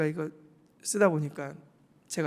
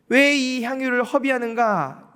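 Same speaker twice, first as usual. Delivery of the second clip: a higher, louder, slow scolding question; scolding disciples; 6 semitones higher, 5 dB louder than usual. Acted. Disciples angrily rebuking the woman for wasting perfume. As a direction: rebuke her for the waste.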